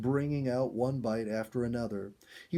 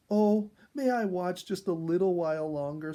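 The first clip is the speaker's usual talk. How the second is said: begging; 6 semitones higher, 5 dB louder than usual. A narrator's reading. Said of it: "Sonia begged".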